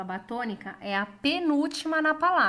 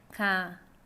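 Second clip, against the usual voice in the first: leitura devagar; 5 semitones lower than usual. Shown as a demonstration. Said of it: "Vamos ler devagar". (bem devagar, sílaba por sílaba)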